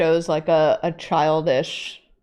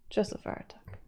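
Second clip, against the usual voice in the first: whispering; 4 semitones higher, 12 dB quieter than usual. Retold or imitated. Whispered insistence.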